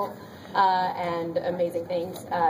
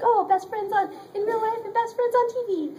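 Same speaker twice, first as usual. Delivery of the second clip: very high, squealed delight; gushing director; 14 semitones higher than usual. Retold or imitated. Imitating the director casting two best friends, gushing. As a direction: gush it in a high, put-on squeal.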